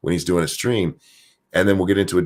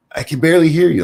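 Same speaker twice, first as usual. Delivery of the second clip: high, louder whiny complaint; complaining viewer; 10 semitones higher, 6 dB louder, faster than usual. Retold or imitated. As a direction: whine like a viewer.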